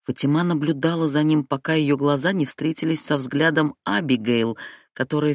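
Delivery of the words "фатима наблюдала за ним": plain reading voice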